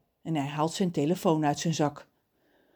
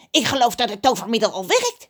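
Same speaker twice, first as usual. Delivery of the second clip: high, loud squawky voice; crow character; 6 semitones higher, 7 dB louder, faster than usual. A narrator's reading. Crow feels the remedy working, high squawk.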